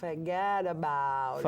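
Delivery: slow low Brooklyn Forget about it; slow Brooklyn accent. Shown as a demonstration. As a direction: draw it out like a Brooklyn wiseguy. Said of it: "I like this".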